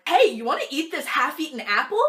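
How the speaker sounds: bright, loud imitation of Mimi offering the apple; imitating Mimi. Friend Mimi offers her half-eaten apple, mock-eager voice. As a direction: playfully act out the friend's offer. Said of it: "and she's like".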